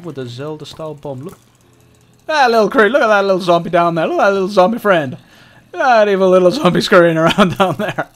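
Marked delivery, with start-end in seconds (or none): 2.3-8.1 excited taunting. Real, not acted. Taunting zombie below, excited.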